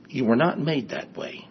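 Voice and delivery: "you were not made that way" said softly at normal pitch; softened repeat. Real, not acted. Preacher repeating the point to the congregation, softly. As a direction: let "you were not made that way" drop soft and sink in.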